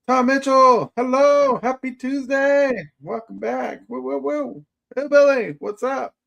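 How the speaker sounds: very high-pitched welcome; high whooping hello, loud at first; excited greeting. Real, not acted. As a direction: hail each arrival in a high, playful voice. Greeting viewers arriving in chat.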